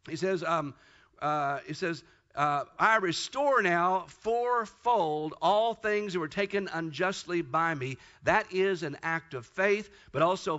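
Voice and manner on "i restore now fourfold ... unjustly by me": slow, weighty reading of the vow; solemn scripture quote, taken slowly. Acted. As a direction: lay each word down deliberately. Quoting Zacchaeus's vow slowly.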